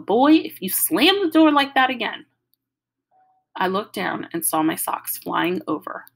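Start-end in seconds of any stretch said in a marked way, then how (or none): 0.0-2.3 put-on higher, louder grandma yell after the boy; yelling grandmother. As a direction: call him back sharply as he runs. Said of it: "Grandma Sands yelling".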